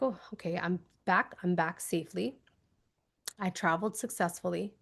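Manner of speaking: quiet, low, soft; soft relieved murmur. Pretend. Back from the visualization, a soft relieved murmur. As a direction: settle back calmly. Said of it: "You're like".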